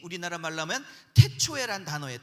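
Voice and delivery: normal talking voice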